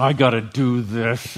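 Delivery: sour, dragging mock complaint; sour grudging. Sour churchgoer serving the Lord, mock grudging groan. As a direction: pout it like sucking a lemon. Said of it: "baptized in lemon juice and just ungracious".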